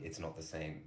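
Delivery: very quiet, low deadpan; barely voiced, dead-faced reply; deadpan, blunt. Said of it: "I just said it so bluntly".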